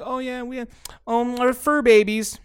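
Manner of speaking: high, put-on gushing voice; mocking pet-owner voice. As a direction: coo it in a mock high voice. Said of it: "'Cause I'm like that. I'm like".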